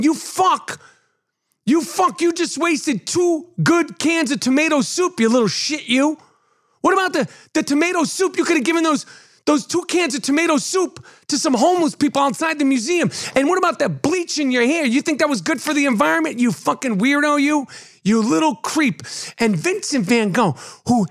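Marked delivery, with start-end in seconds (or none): 0.0-6.2 much higher, ranting at the protesters; mock furious scolding. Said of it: "And I'm saying". 6.8-19.0 mock outraged rant. Ranting at the soup protesters.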